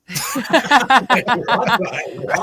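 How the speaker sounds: loud, high burst of laughter; real belly laugh; big laugh. Real, not acted. Host cracking up at his own joke, loud laughter.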